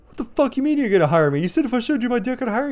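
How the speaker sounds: high, loud indignant imitation; mock furious outburst, pitch way up; mad protest. Cheated friend confronting the boss, mock fury. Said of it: "Singleton got mad".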